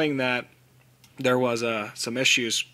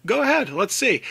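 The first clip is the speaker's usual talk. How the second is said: dismissive challenge; 9 semitones higher, 3 dB louder than usual. Pretend. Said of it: "sit back and say".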